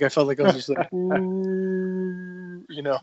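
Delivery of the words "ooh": higher, held-out ooh; long droning ooh. Retold or imitated. Runner describing feeling slowed down, long droning ooh. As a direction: stretch one steady, high ooh. Mimes himself running in slow motion.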